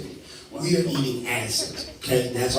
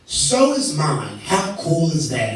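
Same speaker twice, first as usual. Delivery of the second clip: slow, louder, higher gushing delight; hammed-up wide-eyed excitement; mock excited; 3 semitones higher, 5 dB louder, slower than usual. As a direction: drag out the delight like a thrilled stranger.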